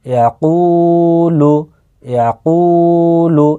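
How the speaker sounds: ইয়াকুলু with a long, held কু; drawn-out vowel. Shown as a demonstration. Teacher demonstrating madd, slow ইয়াকুলু with কু drawn out. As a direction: slowly stretch the কু in ইয়াকুলু. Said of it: "কফের সাথে অ মাদ্দা যুক্ত রয়েছে".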